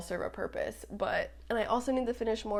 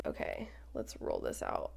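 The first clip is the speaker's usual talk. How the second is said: whisper; 10 semitones lower, 10 dB quieter than usual. Real, not acted. Whispered aside while unrolling tape.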